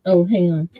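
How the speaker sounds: usual speaking voice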